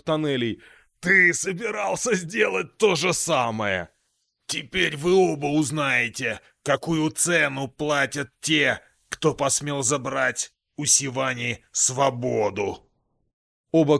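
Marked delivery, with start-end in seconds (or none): 1.0-3.9 demon shout. Acted. Demon accuses fleeing sorcerer. 4.5-12.9 menacing demon voice. Acted. Demon pronounces doom.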